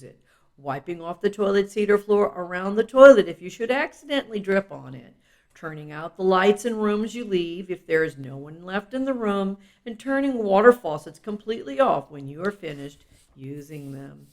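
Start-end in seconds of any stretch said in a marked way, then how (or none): none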